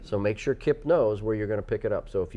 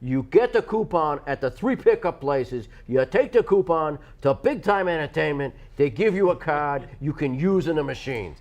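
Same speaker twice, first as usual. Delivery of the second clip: put-on loud, raised New York accent throughout; 4 semitones higher, 4 dB louder than usual. Host repeating coupon steps to co-host in a New York accent. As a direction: push the instructions in a hard New York accent. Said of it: "it feels exhausting to talk like that".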